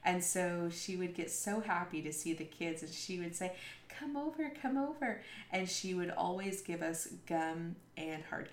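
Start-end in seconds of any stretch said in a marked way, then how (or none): 3.9-5.2 high soft welcoming call; sweet old lady calling. Retold or imitated. Old organ player inviting the church kids, high soft call. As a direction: coax the children over like a kindly old lady.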